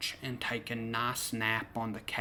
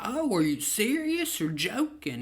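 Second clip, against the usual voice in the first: surprised; 9 semitones higher than usual. Retold or imitated. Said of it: "I was like".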